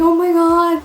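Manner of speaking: high, loud imitation wail; mock bawling. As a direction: wail it as if in tears, hammed up. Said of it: "I was bawling my eyes out".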